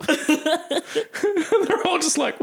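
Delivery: usual speaking voice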